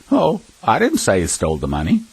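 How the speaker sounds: offhand, light remark, no word leaned on; breezy and unbothered, like it hardly matters; very casual. Shown as a demonstration. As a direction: toss it off casually. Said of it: "somebody could say very casually".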